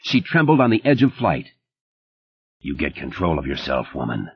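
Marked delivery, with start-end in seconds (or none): 2.6-4.3 low, soft and level, no feeling; a cold command, quiet and flat; flat, deadly cold. A narrator's reading. Stark ordering Liz to calm down, deadly cold and quiet. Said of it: "The words were flat, accentless, and deadly cold".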